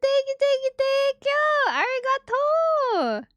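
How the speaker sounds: high, loud, squealing gratitude; excited thanks. Real, not acted. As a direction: gush thanks at the top of your voice. Excited thanks for gifted subs.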